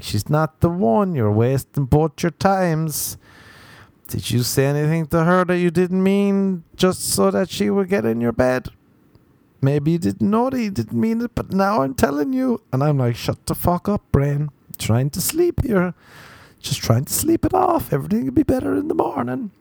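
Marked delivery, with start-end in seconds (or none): 0.0-12.6 high, insistent inner voice; nagging brain. Acted. Brain nagging its owner in bed, high and insistent. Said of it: "My brain is just like". 13.2-19.5 high-pitched protest, climbing as it goes; pleading retort. Acted. Sleeper telling own brain to shut up, pleading high.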